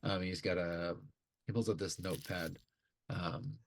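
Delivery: normal talking voice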